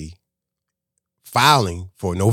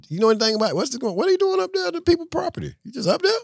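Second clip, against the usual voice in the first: pitched way up and probing; high questioning voice; faster than usual. Retold or imitated. Questioning the worker up on the property, high and probing. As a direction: press him, voice climbing high.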